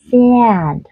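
SAND drawn out like "si-an", tense and nasal; nasalized a. Shown as a demonstration. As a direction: stretch SAND with a tight mouth. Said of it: "căng miệng nhưng nó bị nasalized". (SAND căng miệng, hơi mũi)

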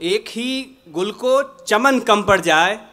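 measured, projected ghazal recitation with pauses; poetry recitation. Acted. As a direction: recite the couplet to a mushaira audience.

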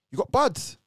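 high, loud sudden question; asking the cellmate about the smoke; surprised question. Retold or imitated. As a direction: blurt it out at the smoke.

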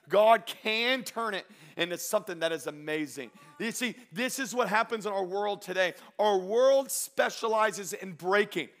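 slow, loud build to a climax; impassioned preacher, words drawn out; full-force preaching. Real, not acted. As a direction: build to a high, ringing proclamation.

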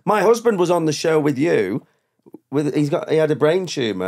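lightly voiced stranger telling her news; retold wife. Retold woman speaking.

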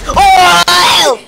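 loud, high startled yelp; startled shout. Real, not acted. Startled by a friend's sudden yell, loud shout. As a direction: blurt it out loud in shock.

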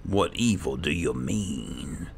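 ordinary delivery

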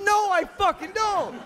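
high, loud, slow protest through a laugh; panicked outburst. Retold or imitated. Panicking actor protests to co-star.

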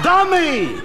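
high, exasperated yell; high-pitched comic insult; exasperated outburst. Acted. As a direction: burst out at a hopeless partner. Exasperated boss berates partner.

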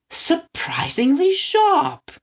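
high, slow, astonished; high slow exclaim. Acted. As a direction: stretch it out in delight. Father character.

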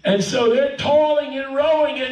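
high, sustained sermon storytelling; preaching build-up. Real, not acted. Preacher telling church of toiling rowers, high intense build-up.

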